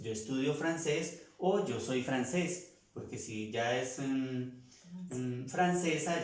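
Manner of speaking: normal talking voice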